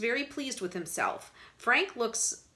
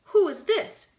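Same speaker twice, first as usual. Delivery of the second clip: haughty; 11 semitones higher, 7 dB louder than usual. A narrator's reading. Kate haughty question.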